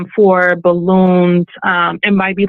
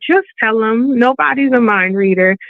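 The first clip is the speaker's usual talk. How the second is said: ordinary talk, nothing marked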